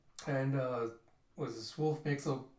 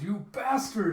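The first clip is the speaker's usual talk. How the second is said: raised, loud attacker's cry; angry yell; 6 semitones higher, 7 dB louder than usual. Retold or imitated. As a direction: yell it as you lunge at him. Voices a kid leaping to attack.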